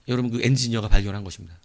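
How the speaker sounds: normal talking voice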